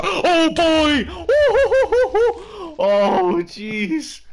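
loud high yelp breaking into nervous laughter; startled panic. Real, not acted. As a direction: yelp in fright, then laugh it off. Sudden scare in game.

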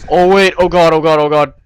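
terrified horror victim. Real, not acted. Panicking while fleeing in horror game.

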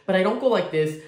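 ordinary delivery

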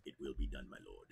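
low, soft obedient murmur; servile villain reply. Acted. Clone answering the dark lord, low and soft. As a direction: answer your master, quietly. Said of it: "Do it again?".

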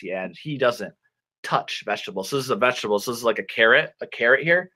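ordinary talk, nothing marked

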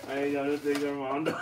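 put-on Mexican Spanish accent, joking; playful Mexican accent imitation; mock Mexican accent. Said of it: "That's how the Mexican people really talk".

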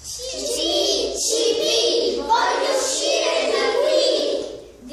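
very high, loud, slow sung-out chick cry; loud drawn-out chirping plea; chick shouting. Acted. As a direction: wail to get out of the nest. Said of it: "gridava lui".

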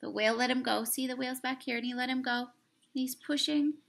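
plain reading voice

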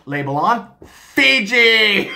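hammed-up worker calling out the brand; factory guy. Factory guy labeling scooped toilet water, hammed-up loud cry. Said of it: "And he's like".

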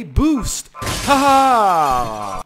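loud, drawn-out whoop of a laugh; triumphant shout. Real, not acted. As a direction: shout the boost, then crow. Triumphant shout while boosting in game.

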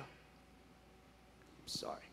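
real emotion, barely whispered apology; choked up. Real, not acted. Storyteller overcome by her testimony, choked-up whisper. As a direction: whisper it, overcome, almost voiceless.

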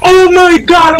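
loud, high burst of joy; real delighted shout; excited shout. Real, not acted. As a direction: yell it out thrilled. Thrilled at player's comeback.

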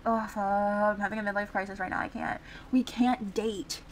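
ordinary talk, nothing marked

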